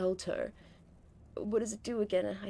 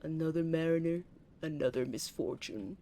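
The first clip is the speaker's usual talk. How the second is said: narrator impression; 2 semitones lower than usual. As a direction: intone it like the grim game narrator. Echoes game narrator's line.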